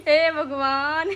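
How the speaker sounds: slow, breathless laughing sigh. Real, not acted. Niece laughing at her shy aunt, slow breathless laugh. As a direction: laugh it out, helpless.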